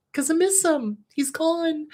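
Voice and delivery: real grief, voice pitched high; tearful. Real, not acted. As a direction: say it high, on the edge of tears. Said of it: "I'm gonna tear up again".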